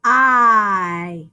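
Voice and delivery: "I" held as a long vowel sound. Shown as a demonstration. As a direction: stretch the long I. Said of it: "I hear that long vowel".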